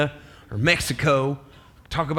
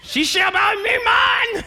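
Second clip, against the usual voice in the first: much higher, drawn-out delighted claim; giddy Adam. Acted. Adam showing off over the woman, giddy and high. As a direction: crow it like a proud showoff.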